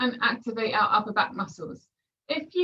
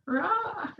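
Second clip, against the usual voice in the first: short playful zombie roar; zombie growl; 6 semitones higher, 5 dB quieter than usual. Pretend. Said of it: "It's a bit like a lying down zombie".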